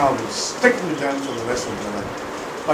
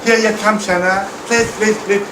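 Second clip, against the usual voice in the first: pleading parent; 7 semitones higher than usual. Acted. Parent begging other child.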